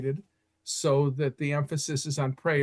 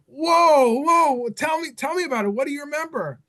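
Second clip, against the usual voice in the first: much higher and louder, bursting with eagerness; eager amazement; 11 semitones higher, 6 dB louder than usual. Retold or imitated. Narrator urging the survivor to tell, high and excited. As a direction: jump in, desperate to hear more.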